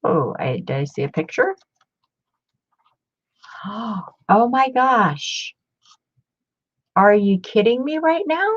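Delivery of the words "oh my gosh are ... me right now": gasping surprise. Real, not acted. Finding an old photo in the book, gasping in amazement. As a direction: caught completely off guard, delighted.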